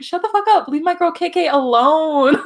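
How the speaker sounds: high, loud acted-out outburst; mock-angry yell breaking into a laugh; exasperated retort. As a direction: act out telling them off, high and forceful. Acts out annoyance at baby talk.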